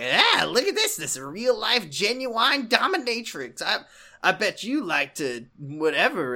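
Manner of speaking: hammed-up creep voice; jeering street creep. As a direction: crow over the find like a street punk.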